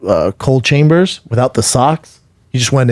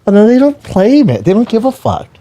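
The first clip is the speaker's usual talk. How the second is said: high put-on voice of a jaded insider; lightly voiced know-it-all; knowing mock voice; 10 semitones higher than usual. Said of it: "I'm like".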